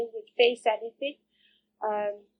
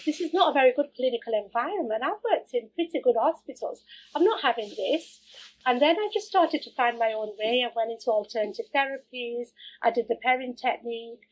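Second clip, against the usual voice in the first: usual speaking voice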